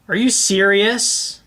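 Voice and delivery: ordinary talk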